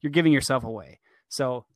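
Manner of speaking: normal talking voice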